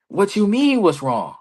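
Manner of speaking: retold heated retort, starting high. Wrestler retelling his comeback to a colleague, heated. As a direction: throw the question back, mock indignant. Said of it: "I'm heated".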